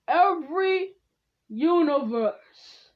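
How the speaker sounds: loud, high, drawn-out summons; calling out. Acted. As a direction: proclaim it slowly to the whole multiverse. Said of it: "he calls out".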